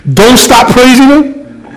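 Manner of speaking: ordinary talk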